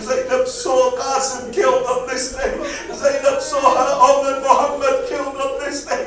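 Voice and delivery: a preacher's lament swelling with every name; mounting lament. Acted. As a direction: pile the grief up line by line, pressing harder. Lament rising each repetition.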